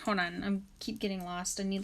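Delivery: ordinary talk, nothing marked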